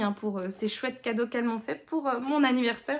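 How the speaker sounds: ordinary talk, nothing marked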